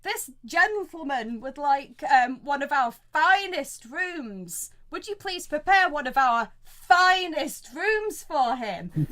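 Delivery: slow, stretched emphasis on the fancy words; pointed request. Acted. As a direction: lay the grand courtesy on thick. Innkeeper pointedly orders best room prepared.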